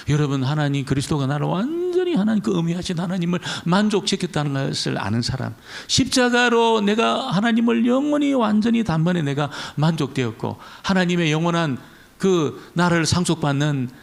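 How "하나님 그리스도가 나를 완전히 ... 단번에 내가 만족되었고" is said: normal talking voice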